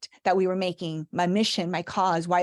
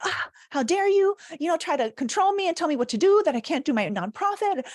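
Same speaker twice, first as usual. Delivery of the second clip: mock hot-headed outburst; 7 semitones higher than usual. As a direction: play the fiery Cuban who feels told what to do. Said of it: "throw the champagne in his face and be like".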